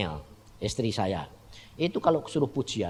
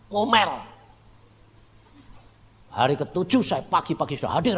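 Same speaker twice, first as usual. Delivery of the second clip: normal talking voice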